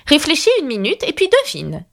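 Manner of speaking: high-pitched royal instruction; imperious queen. A narrator's reading. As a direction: tell her firmly, as a queen. Red Queen gives Alice an order. (reine autoritaire, voix haut perchée)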